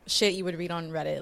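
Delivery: ordinary talk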